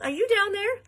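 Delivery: high, ringing loud question; shouted. A narrator's reading. Mother searching for her daughter down the hole, shouting. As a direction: holler it down, pitched high. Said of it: "shouted Missus Salt".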